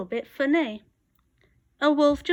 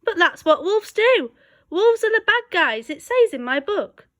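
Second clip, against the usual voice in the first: higher, louder, indignant wolf; protesting wolf; 7 semitones higher, 6 dB louder than usual. A narrator's reading. Wolf defending his role to the librarian, indignant. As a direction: insist it's just what wolves do.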